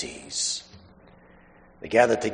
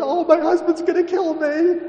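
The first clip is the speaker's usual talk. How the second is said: imitating a tearful mother in a thin, much higher mock wail; panicky high wail; 3 dB louder than usual. To the officer who stopped her, a high mock panicky wail. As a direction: cry out in a high hammed-up panic. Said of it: "I started to cry".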